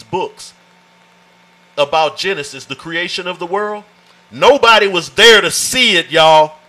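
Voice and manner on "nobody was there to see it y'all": loud preacher's call; preaching loud. Real, not acted. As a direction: punch it out to the congregation. Preacher driving home a point.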